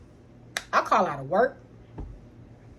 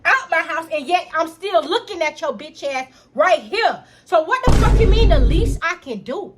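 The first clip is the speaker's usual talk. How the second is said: building to a loud, high furious rant; losing my mind; 4 semitones higher, 7 dB louder than usual. Retold or imitated. Sister yelling at freeloading brother, furious. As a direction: let the rage climb to a yell. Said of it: "I literally lost my motherfucking mind".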